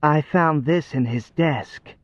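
put-on slow, lilting girl voice; caroling. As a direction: sing it out sweetly. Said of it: "she caroled".

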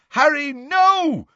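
high, drawn-out cry of warning; cried out. A narrator's reading. Lupin trying to halt Harry's chase, crying out. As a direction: cry out to stop him. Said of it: "cried Lupin".

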